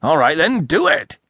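high, loud impatient demands; irritated. A narrator's reading. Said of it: "Somewhat calmed".

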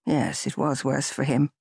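ordinary delivery

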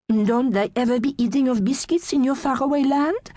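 put-on light French accent, curious question; French accent, puzzled; French-accented Gigi. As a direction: Gigi with a French lilt, asking. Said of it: "Gigi asks".

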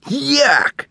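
high disgusted yelp; exclamation. A narrator's reading. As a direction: squeal it out in disgust. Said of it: "Tan exclaims".

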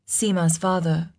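ordinary delivery

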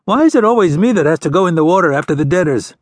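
a sulky son's quick protest; whining. Acted. Son whining to his father about always going in the water. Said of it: "the young man whined".

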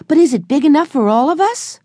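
put-on a small boy bursting with delight; excited little boy. A narrator's reading. Benny calling out to everyone from the boat, high and childlike.

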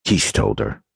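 plain reading voice

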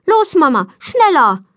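loud, high impatient call; pressing child's exclamation; urging on. A narrator's reading. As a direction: hurry Mama along. Impatient child hurrying her mother.

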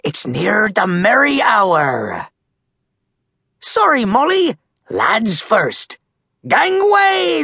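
high, hearty seadog voice; pirate captain calling out. Acted. Captain Red hurrying the lads, pirate captain's call. Captain hails crew and Molly.

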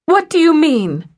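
jolted, as if punched; woman hearing her ex is dead, shocked cry. A narrator's reading. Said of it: "She jerked back as if punched".

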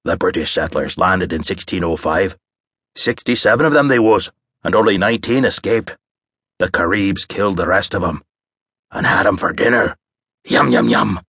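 a hint of a menacing pirate yarn; rumbling drawl. A narrator's reading. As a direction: lean in and spin the grisly tale. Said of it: "lowered his voice into a rumbling drawl".

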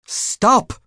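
high, loud snapped command; sharp order. A narrator's reading. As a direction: stop him dead, sharply. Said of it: "Scarlett ordered him".

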